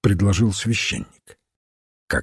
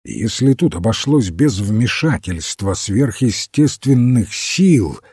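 plain reading voice

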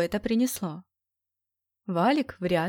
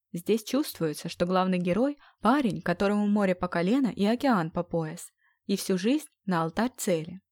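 ordinary delivery

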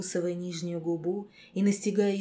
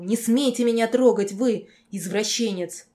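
shrill shriek, much louder; 3 semitones higher, 7 dB louder than usual. A narrator's reading. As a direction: screech at him in fury. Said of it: "Вообще визг".

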